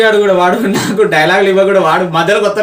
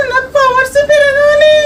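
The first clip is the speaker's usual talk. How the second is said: high, slow, strained chant; menacing power-hungry villain; slower than usual. Acted. Prankster demands powers before scared boy, villainous menace. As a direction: stretch it out like a possessed villain.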